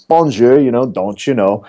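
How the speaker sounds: mock French bonjour sliding into Minnesota twang; fake Minnesotan French accent. Minnesota hotel staff playing French for a guest, fake French accent slipping. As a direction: imitate a Minnesotan faking French. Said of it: "fake French accents and they're all in Minnesota".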